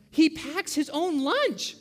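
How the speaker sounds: high, slow punchline through laughter; laughing wife. Acted. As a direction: draw out the punchline, amused and high. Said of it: "And the laughing one, she goes".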